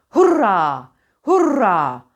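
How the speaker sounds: "Hurra" with a long trilled R, punched out; "Hurra" as a forceful trill exercise; rolled R drill. Shown as a demonstration. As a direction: roll hard into the final A of "Hurra".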